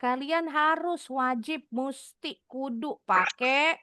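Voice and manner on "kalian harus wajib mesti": ordinary talk, nothing marked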